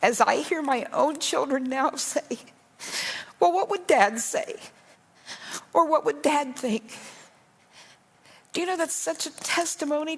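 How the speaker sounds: fighting back tears while speaking; real emotion pushing the voice up; emotional. Real, not acted. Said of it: "I'm sorry that I'm so emotional".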